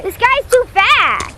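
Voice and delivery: frustrated shout. Real, not acted. Chasing fish.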